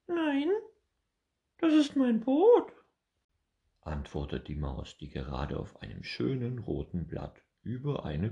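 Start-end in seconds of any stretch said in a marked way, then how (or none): 0.1-2.7 very high, squeaky mouse voice; a small mouse correcting the squirrel; squeaky little mouse answering; tiny mouse. Acted.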